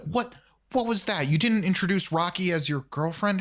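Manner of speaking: mock disbelief, high-pitched and rising; incredulous scolding. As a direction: imitate an appalled friend demanding an explanation. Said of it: "and she's like".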